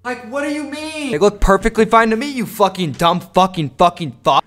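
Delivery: high-pitched outraged cursing; indignant rant. Pretend. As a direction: protest in a high voice, slowing into stuttered swearing. Mock outrage over insulted legs.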